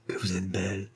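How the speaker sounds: soft, hushed admiring murmur; murmured in awe. Acted. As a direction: breathe it out softly, enraptured. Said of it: "murmura Maxou qui la contemplait avec extase". (murmure doux et émerveillé)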